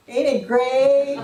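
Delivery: loud, high, long-drawn Southern drawl. Retold or imitated. Speaker after announcing a death, exaggerated Southern drawl. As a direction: belt the catchphrase in a stretched Southern drawl. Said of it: "said it with this southern drawl".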